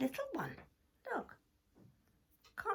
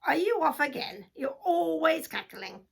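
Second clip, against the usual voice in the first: loud, higher accusing sheep; sheep character; 5 semitones higher, 8 dB louder than usual. Acted. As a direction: challenge the hen as a nosy sheep. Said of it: "the sheep came along".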